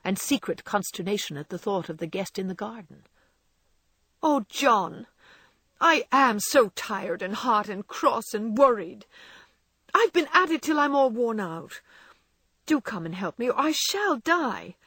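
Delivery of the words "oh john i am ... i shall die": tearful wife. A narrator's reading. Exhausted wife begging her husband for help, high and tearful. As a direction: plead for help, close to tears. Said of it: "the exhausted housewife".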